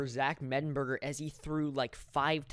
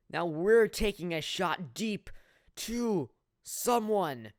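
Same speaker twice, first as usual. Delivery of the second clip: raised voice, slowing on the last words; suspenseful play-by-play; 4 semitones higher, 4 dB louder, slower than usual. Real, not acted. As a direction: hang on the target as the ball flies. Calling a long pass.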